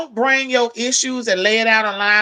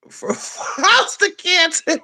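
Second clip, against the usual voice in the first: laughing; 8 semitones higher, much slower than usual. Real, not acted. Laughing at the viewer's choice.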